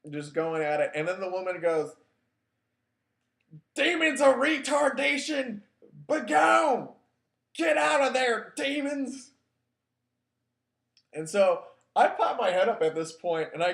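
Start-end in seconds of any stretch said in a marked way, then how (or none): 3.7-9.3 high, loud preacher's command; commanding exorcism. Retold or imitated. Faith healer shouting at demons over the boy, loud preacher command. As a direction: cast the demons out like a revival healer.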